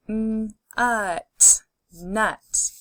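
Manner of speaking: d, ot, dot, parts pulled apart then joined; D, ot, dot: each part separate, then the whole word; segmented then blended. Shown as a demonstration. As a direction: sound it out and blend it. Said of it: "Say the sounds with me and blend together".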